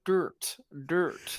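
attempted American dirt with a hard r; American accent. Shown as a demonstration. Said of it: "is that your American accent?".

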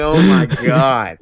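exasperated. Retold or imitated. Stuck in the drunk tank with a screaming friend, exasperated. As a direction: drag it out, fed up and laughing.

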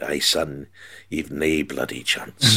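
low, soft, slow Scottish Shankly voice; Scottish Shankly impression. Shankly telling the boy they have no chance, low Scottish voice. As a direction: drawl it out like Shankly in a Scottish accent. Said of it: "he was Scottish".

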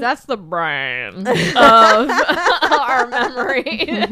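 laughing through the words, slow and loud. Real, not acted. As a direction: giggle through the joke. Jokes, laughs mid-sentence.

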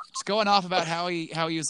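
ordinary talk

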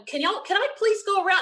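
high-pitched frantic request; retold pleading. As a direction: plead to be let past, voice jumping up. Retold pleading in security line.

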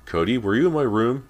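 high shouted question; shouting. A narrator's reading. Girl calling to her sister from her room, shouting. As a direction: shout it to someone out of sight. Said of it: "she shouted".

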